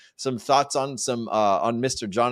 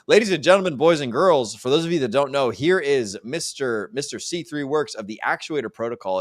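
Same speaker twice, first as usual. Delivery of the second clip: hyped announcer intro; 4 semitones higher than usual. Acted. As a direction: build it up like a stage announcer. Host introduces guest on stage.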